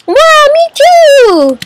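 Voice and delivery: put-on very high, drawn-out kid squeal; Little Timmy. Child character thrilled to share a love of ice cream, high squeal. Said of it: "I like Little Timmy".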